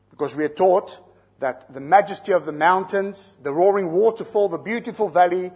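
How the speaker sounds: loud, rising pulpit delivery; preaching at full force. Acted. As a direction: build the sermon to full force. Builds to a loud climax.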